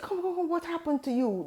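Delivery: high, fast, coaxing call; like a kind elder calling a lost student over; concerned professor. Retold or imitated. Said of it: "he saw me and he said".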